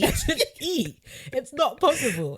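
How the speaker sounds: squealed mock outrage. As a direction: play up the horror of it.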